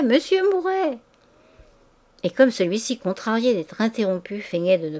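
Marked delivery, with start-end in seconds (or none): 0.0-1.0 high calling of his name, falling at the end; insist, calling him over once more; ladies calling again. A narrator's reading. Ladies in the salon calling Mouret over, high and insistent. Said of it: "Des voix répétaient au milieu de légers rires".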